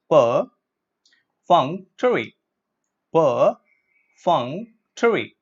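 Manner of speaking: "Perfunctory" very slowly, per, FUNK, tuh-ree; syllable-by-syllable pronunciation. Shown as a demonstration. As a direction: chop "Perfunctory" into three slow, separate parts. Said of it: "let's look at the syllable breakup".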